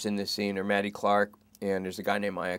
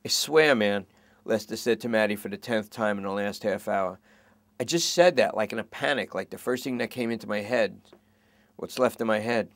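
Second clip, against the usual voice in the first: raised, earnest protesting. Acted. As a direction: insist it was just panic talking. Lester pleading.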